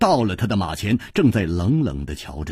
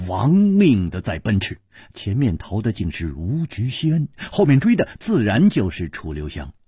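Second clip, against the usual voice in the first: usual speaking voice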